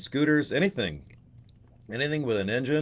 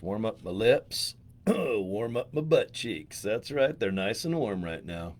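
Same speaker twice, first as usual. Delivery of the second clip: ordinary talk, nothing marked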